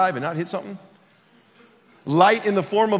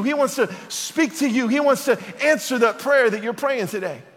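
raised, driving preacher's cadence; impassioned preaching; 4 semitones higher than usual. Acted. Pastor urging the congregation, impassioned preaching. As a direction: preach it out, lifting the room.

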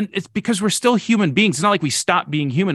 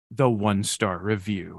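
ominous reveal; 7 semitones lower, 6 dB quieter, much slower than usual. Retold or imitated. Dramatic reveal before buying.

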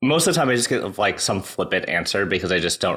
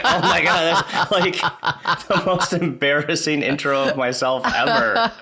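laughing through embarrassment; 7 semitones higher than usual. Retold or imitated. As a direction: laugh it out, mortified. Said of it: "I'm like".

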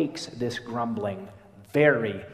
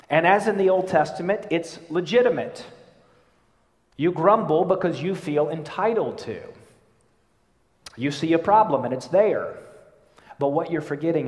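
higher, louder sermon push; preaching at full force; 6 semitones higher, 6 dB louder than usual. Acted. Preacher conceding the grumbler's case, loud and insistent. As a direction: press the point hard.

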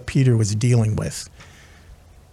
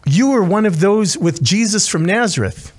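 put-on a higher, pointed accusation from a young servant girl; accusing servant girl; 7 semitones higher than usual. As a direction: point the finger at him, lightly voiced girl.

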